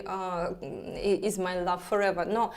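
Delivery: normal talking voice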